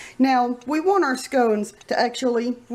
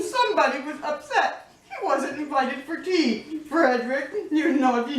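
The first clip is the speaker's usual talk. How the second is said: usual speaking voice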